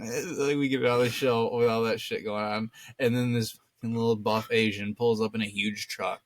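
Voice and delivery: ordinary delivery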